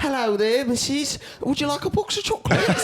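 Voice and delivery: high, sing-song, overly sweet greeting; mock polite English visitor. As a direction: ham up a chirpy English tourist at the door. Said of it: "You're English, mate".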